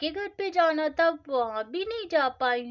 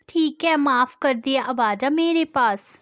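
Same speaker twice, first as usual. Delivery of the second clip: forgiving call; 3 semitones lower, 9 dB louder than usual. Acted. Mother forgives daughter.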